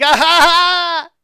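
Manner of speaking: high, loud triumphant cackle; gloating laugh. Retold or imitated. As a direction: cackle at the caught driver. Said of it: "I was like".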